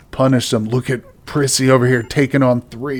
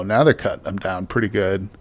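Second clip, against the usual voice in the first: ordinary talk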